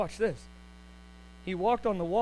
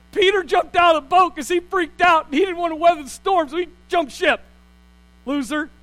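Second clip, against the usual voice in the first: high, loud teasing rant; playful loud scorn; mocking excitement; 9 semitones higher, 10 dB louder than usual. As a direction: tease Peter for jumping ship. Mocking Peter freaking out.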